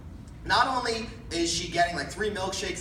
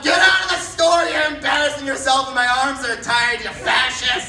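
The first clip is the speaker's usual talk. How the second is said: higher, louder scolding shout; 6 semitones higher, 9 dB louder than usual. Retold or imitated. The old lady shouting the angry man out of the store. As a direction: shout him down, fed up. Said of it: "the old lady just shouts out".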